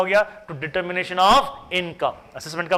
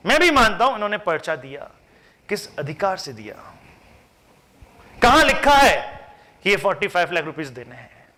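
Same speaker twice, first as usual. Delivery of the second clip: ordinary delivery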